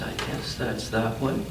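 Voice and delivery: plain reading voice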